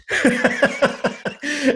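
a burst of high-pitched real laughter. Real, not acted. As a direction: crack up at the punchline. Laughing at own story's punchline.

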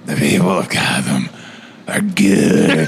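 low, halting hero declaration; hammed-up Batman, pausing for weight; over-the-top Batman. Batman vouching for the people of Gotham, over the top. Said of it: "that part when he was like".

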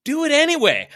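high, full-volume motivator impression; mock shouted command. Speaker overruling the house seller, mock shouted command. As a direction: yell it over the objection, hammed up.

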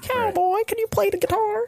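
very high falsetto plea; soft, squeaky pretend character; silly put-on voice. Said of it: "sounding tired and doing voices".

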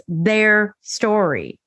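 "their" drawn out slowly and set apart; slow right down to weight "their"; stress on "their". Real, not acted. Defending friends' accounts, "their" slow and emphatic.

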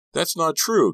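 high, loud, breaking voice; voice cracking. A narrator's reading. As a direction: voice cracks on a desperate denial. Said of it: "his voice cracking".

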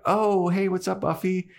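high, breezy mom voice easing down; chatty mom. Acted. Joyce greeting Buffy at home, chatty and high. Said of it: "And Joyce is like".

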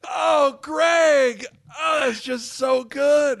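gleeful cry of delight; overjoyed, almost shouting. Real, not acted. Delighted reaction.

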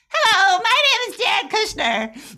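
a squeaky, halting hello from a man you expected to be imposing; Jared Kushner impression. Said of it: "and he goes".

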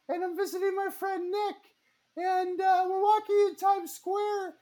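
very high falsetto storytelling; highest voice. Pretend. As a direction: tell it in your highest squeak. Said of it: "in your highest voice".

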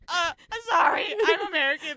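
high, whiny mock apology; embarrassed. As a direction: imitate a mortified tourist apologizing. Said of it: "she was so embarrassed".

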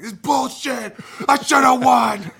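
loud, high yelling at the result; frustrated shout. Retold or imitated. Retelling a teammate's outburst, shouted loud and high. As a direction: bellow it like a sore loser.

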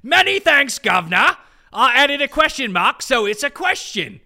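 loud, high-pitched hammed-up British accent. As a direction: put on a loud, over-the-top British caricature. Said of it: "I'm gonna do the accent".

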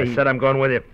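sudden loud snarl, pitch up; a menacing shout that cuts him off; angry command. Acted. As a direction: bark it as a threat. Thug forcing his way along.

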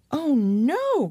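high, alarmed "oh no" cry. A narrator's reading. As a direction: cry out in sudden dismay. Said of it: "cried Annalise".

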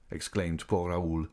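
usual speaking voice

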